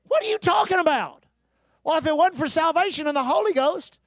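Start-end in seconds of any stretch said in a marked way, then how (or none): 0.1-1.3 sharp, incredulous shout; indignant retort. Retold or imitated. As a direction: snap back in disbelief. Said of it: "instead of saying".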